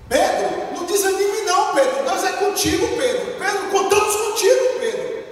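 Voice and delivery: bright, eager encouragement for Pedro; lively, encouraging. Shown as a demonstration. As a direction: lift him up with energy. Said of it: "Olha a diferença".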